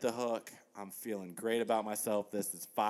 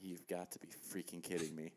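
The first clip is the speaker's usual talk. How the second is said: muttered disbelief; 3 semitones lower, 11 dB quieter than usual. Retold or imitated. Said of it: "I was so pissed".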